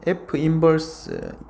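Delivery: "F 인버스" drawn out slowly, word by word; reading demonstration. Shown as a demonstration. Teacher showing how the notation is read, slow careful "F 인버스". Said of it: "읽는 거는 이제 F 인버스라고".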